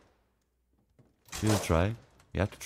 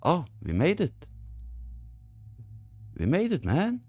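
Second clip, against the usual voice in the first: relieved excitement; 5 semitones higher, 5 dB quieter than usual. Real, not acted. Relief after escaping.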